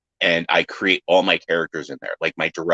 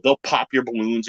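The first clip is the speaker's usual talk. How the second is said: ordinary delivery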